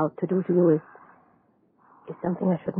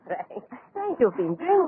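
incredulous; 9 semitones higher than usual. Acted. Doctor doubts the servant's news.

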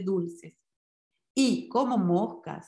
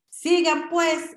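higher, louder curt command; gendarme's order; 7 semitones higher, 5 dB louder, slower than usual. A narrator's reading. Policeman ordering passers-by to move on, loud and curt. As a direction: bark at the passers-by to move along. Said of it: "les ordenó el gendarme".

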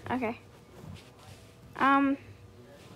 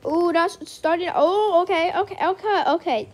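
excited; 5 semitones higher, 3 dB louder than usual. Real, not acted. Excited at getting trade.

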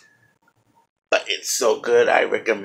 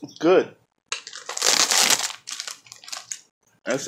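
chewing while talking; muffled by food; mouth full; 5 semitones higher, slower than usual. Real, not acted. Seen 3: hand to mouth at -1, lips pressed and chewing through speech.

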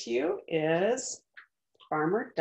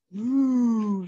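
louder, drawn-out moo; cow's moo; 3 semitones higher, 6 dB louder than usual. Acted. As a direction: moo like a cow in the plan. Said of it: "said the cow".

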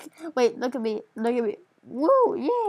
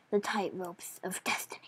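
dramatic whisper; 4 semitones lower, 8 dB quieter than usual. Acted. Dramatic game announcement.

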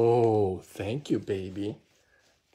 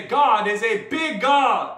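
louder, slower, with conviction; like you mean it; 3 semitones higher, 6 dB louder, slower than usual. Shown as a demonstration. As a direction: proclaim it firmly for kids to echo. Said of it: "Say it like you mean it".

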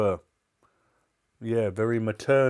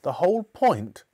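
high, loud echo question; outraged, raised-voice query; incredulous mother; 7 semitones higher, 5 dB louder than usual. Acted. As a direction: throw it back in disbelief. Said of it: "Mother asked".

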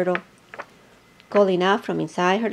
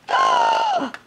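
gasp. A narrator's reading. Dream wish granted.

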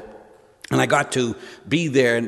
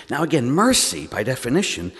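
normal talking voice

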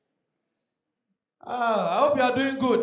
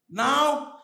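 ordinary delivery

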